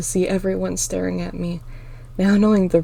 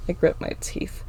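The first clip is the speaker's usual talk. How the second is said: ordinary delivery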